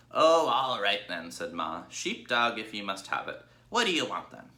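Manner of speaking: Ma conceding to Babe, grudging; grudging old ewe. Acted. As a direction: give in with a grumble. Ma's voice in story.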